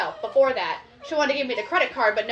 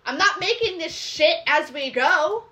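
ordinary talk, nothing marked

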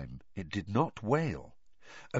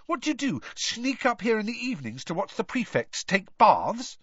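put-on indignant teen boy; 12 semitones higher than usual. Harry voiced, indignant.

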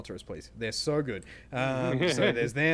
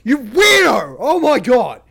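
berserk rage; 14 semitones higher, 21 dB louder than usual. Pretend. Mock rage at pork answer.

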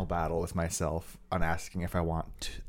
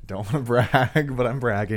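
sheepish pride; 4 semitones higher, 8 dB louder than usual. Real, not acted. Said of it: "You're basically hyperventilating over there".

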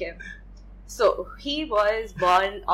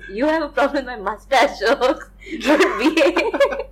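high, loud and laughing; teasing through laughter; 5 semitones higher, 6 dB louder than usual. Real, not acted.

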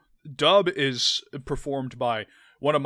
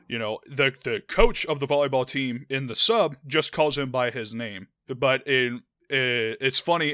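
ordinary delivery

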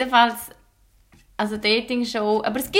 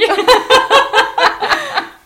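loud high laugh; 13 semitones higher, 10 dB louder than usual. Real, not acted. Laugh after Baby.

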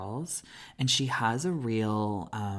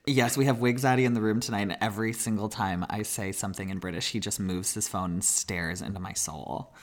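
ordinary delivery

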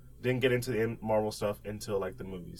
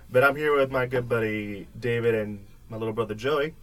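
ordinary delivery

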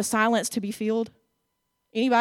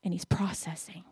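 whisper; 4 semitones lower, 9 dB quieter than usual. Acted.

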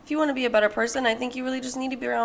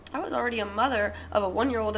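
usual speaking voice

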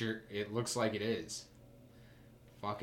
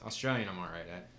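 usual speaking voice

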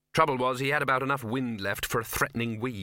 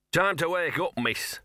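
dog growling a wake-up call; lightly growled, starting high; 6 semitones higher than usual. A narrator's reading. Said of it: "he growled".

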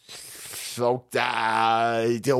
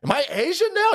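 much higher, mock confused question; 12 semitones higher than usual. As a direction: ask it in a baffled, high voice.

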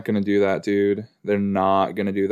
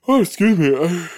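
higher, louder words through a real yawn; 7 semitones higher, 5 dB louder than usual. Real, not acted. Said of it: "I just yawned".

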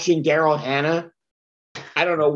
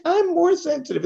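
much higher mock female retort; one-upping girlfriend; 12 semitones higher than usual. Comic plays actress arguing with singer boyfriend, high retort. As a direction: pitch it way up to outdo him. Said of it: "She's like".

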